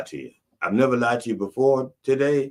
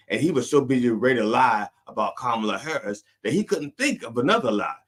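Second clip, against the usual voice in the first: ordinary talk, nothing marked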